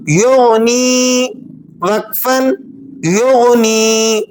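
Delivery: یغنی with the final ی drawn out slowly; stretched ending. Shown as a demonstration. Teacher stopping on یغنی with a long vowel. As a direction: hold the last vowel long. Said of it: "تھوڑا سا کھینچ کر وقف کر رہے ہیں".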